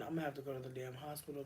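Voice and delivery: ordinary talk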